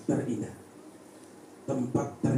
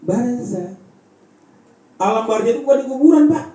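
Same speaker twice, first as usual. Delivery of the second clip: fiery preaching; 8 semitones higher, 9 dB louder than usual. Acted. Preacher to the village congregation, loud and high. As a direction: preach it to the congregation with full force. Preacher explains death, raising voice.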